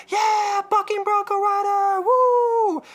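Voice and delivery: high, shouted crowd cheer. Retold or imitated. Comedian voices the crowd cheering the bronco rider, shouted. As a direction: yell it like a hyped fan.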